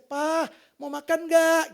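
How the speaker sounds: imitating the wife's slow, loud call to eat. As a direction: call him to the table the way the wife used to. Wife calling him to eat.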